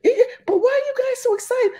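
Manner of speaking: very high squeaky caricature, fast; shrieking clueless owner, rushed; high falsetto confused Becky; mock Becky. Owner voiced in story.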